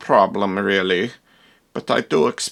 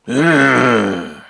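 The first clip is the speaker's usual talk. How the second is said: monster grunt. Acted.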